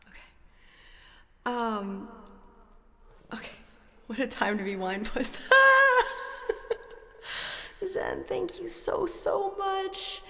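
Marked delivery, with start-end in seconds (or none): 5.4-6.9 loud high burst of laughter; laughing at her own joke. Real, not acted.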